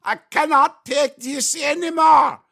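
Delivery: high-pitched shouted German caricature; over-the-top German accent, strained and high; mock German accent. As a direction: cry it out in a hammed-up German accent. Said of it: "is that how German people talk".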